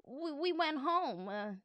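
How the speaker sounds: hesitant, stumbling excuse; halting, uneasy boy's reply; nervous stammer. A narrator's reading. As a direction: trip over the first word, then drag it out. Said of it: "Harold stammered nervously".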